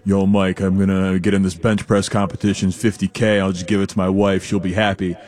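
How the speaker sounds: low, mumbly voice, lightly put on; Larry Allen. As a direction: imitate a deep-voiced mumbler. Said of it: "like, deep, mumbly voice".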